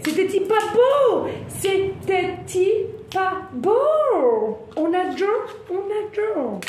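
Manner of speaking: very high, stretched singsong exclamation; excited gushing. Real, not acted. Tarot reader delighted by the cards, excited gushing. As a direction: crow with delight, drawing the words out.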